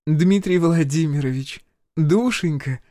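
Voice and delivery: high-pitched, slow, sugary greeting; beaming affection. A narrator's reading. Healer greets her powerful friend by phone, beaming. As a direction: greet him with an audible smile. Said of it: "разулыбалась невидимому собеседнику целительница".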